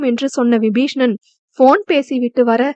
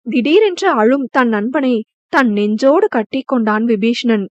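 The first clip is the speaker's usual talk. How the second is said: usual speaking voice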